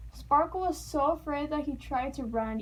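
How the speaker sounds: usual speaking voice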